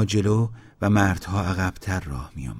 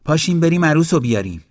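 loud, higher and quick; rally the crowd out loud; loud announcement; 8 semitones higher, 7 dB louder, faster than usual. A narrator's reading. Groom's mother summons the crowd to fetch the bride, loudly. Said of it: "با صدای بلند به جماعت گفت".

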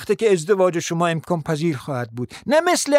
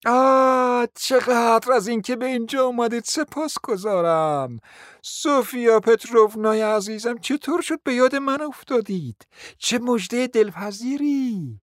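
gushing welcome; 4 semitones higher than usual. Acted. Hostess greeting her newly arrived guest, effusively delighted. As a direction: open on a big "ah" and gush.

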